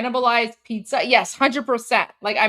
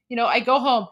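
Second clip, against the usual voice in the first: normal talking voice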